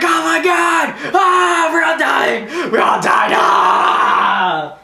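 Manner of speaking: high hammed-up screaming; imitated terrified crowd screams; mock mass panic. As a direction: shriek like panicking passengers. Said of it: "everyone's panicking".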